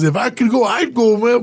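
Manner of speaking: high-pitched earnest friend voice; retold insistence, pitched well up; imitated urgent pleading. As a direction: urge him on as his friend would. Voicing his friend urging him to go.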